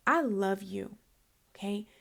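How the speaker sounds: soft, low and hushed; tender. Retold or imitated. Aunt to nephew at night, soft tender reassurance. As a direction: murmur it like a lullaby to him. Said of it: "I was like".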